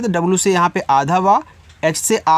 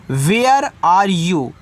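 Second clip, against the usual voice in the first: each word slow and clear, "Where" on a falling tune; slow model sentence; much slower than usual. Shown as a demonstration. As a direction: model it slowly for students. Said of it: "आप use करिए". (धीमा, साफ़ उदाहरण वाक्य)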